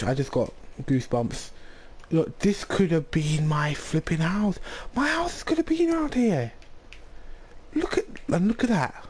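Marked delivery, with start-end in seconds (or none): 4.9-9.0 high exasperated outburst. Real, not acted. Frustrated outburst at lost village spot.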